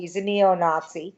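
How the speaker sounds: ordinary delivery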